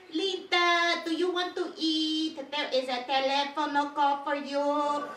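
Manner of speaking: put-on high, loud syllable-by-syllable Filipino accent; mock Filipino accent.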